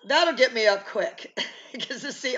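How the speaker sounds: ordinary talk, nothing marked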